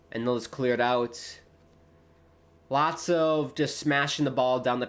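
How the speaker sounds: lively live match call; play-by-play commentary. Acted. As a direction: call the action as it happens.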